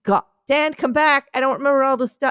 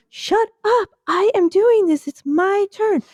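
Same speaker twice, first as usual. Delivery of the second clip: higher squeaky shoe snapping back; the first shoe fights for its turn; first shoe, bickering; 10 semitones higher than usual. Pretend. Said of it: "I'm a shoe".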